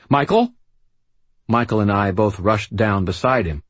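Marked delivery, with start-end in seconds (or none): 0.1-0.5 high, loud, startled cry; alarmed call. Acted. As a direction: call out in shock. Harry startled by the dying man.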